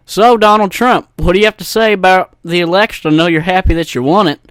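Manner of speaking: loud, high, hammed-up DJ interview; fake call-in radio host. Radio host questioning a fake caller, hammed-up. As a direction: pitch the question up big like a DJ. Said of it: "fake call-ins where it's just".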